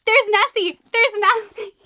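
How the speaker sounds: loud, high excited cries; real excitement. Real, not acted. Book fan spotting Nessie on the book, excited shouting. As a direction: shout it out in delight.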